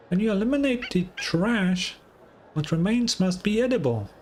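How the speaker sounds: pitched well up, a confident little maxim; high-pitched proud quip. Acted. Quirky companion proclaiming a motto, pitched high. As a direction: announce it like a cheeky know-it-all.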